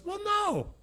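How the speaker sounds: imitating a girl, very high-pitched; mock-incredulous, high-pitched concession; obvious no. Girl answering his obvious soccer question, incredulous.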